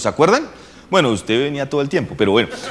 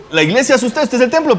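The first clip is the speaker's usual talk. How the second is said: laughing while preaching; 10 semitones higher, faster than usual. Real, not acted. Preacher laughing over his point.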